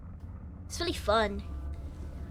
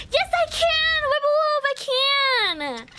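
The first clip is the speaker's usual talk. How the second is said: excited; 9 semitones higher, much slower than usual. Real, not acted. Excited reply to birthday drawing request.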